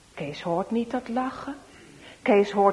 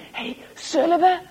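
sly eagerness; 7 semitones higher, 4 dB louder than usual. Acted. Boys plotting a prank.

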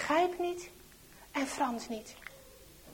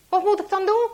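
high, loud, snappish question; shouted snarl; 8 semitones higher, 12 dB louder, faster than usual. A narrator's reading. Traitor snaps at Henk asking for help, shouting. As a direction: shout it back impatiently. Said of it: "schreeuwt en snauwt hij".

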